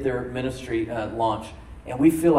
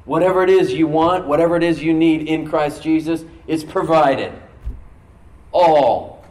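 loud, ringing declaration of all; preaching climax; 4 semitones higher, 9 dB louder than usual. Acted. Pastor declaring God's grace over the new year, loud. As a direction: declare it with full force.

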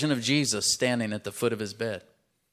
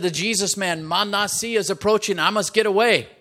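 high and loud, rising in alarm; panicked demon; 7 semitones higher, 5 dB louder than usual. Acted. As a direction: shriek it out like a fleeing demon. Demon fleeing the boy.

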